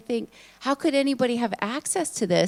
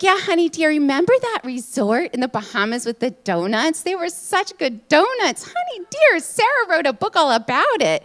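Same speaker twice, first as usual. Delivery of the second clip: put-on 1 loud old aunt; 5 semitones higher, 5 dB louder than usual. Aunt voiced in reunion story.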